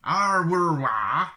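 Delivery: loud, high mock Wookiee roar. Shown as a demonstration. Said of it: "jump to hyperspace".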